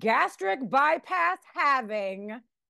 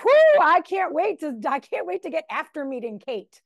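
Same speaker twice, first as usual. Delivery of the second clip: imitating husband's cheer; 5 semitones higher, 6 dB louder than usual. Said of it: "he's like".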